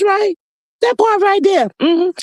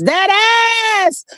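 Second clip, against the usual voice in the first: high gleeful exclamation; gleeful exclaim; 9 semitones higher than usual. Real, not acted. Gloating to viewers about the news, high and gleeful. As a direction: crow it out, delighted.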